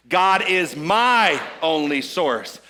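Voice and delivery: "my" held long and punched, slow line; stress on my. Shown as a demonstration. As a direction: hit the word "my" and stretch it. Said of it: "emphasize a different word".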